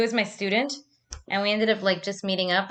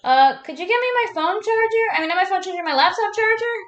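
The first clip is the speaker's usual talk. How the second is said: high, raised voice to someone away; calling out; 10 semitones higher, 6 dB louder than usual. Real, not acted. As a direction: call a request across the house. Calling to Basti off camera.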